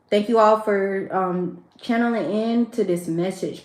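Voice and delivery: usual speaking voice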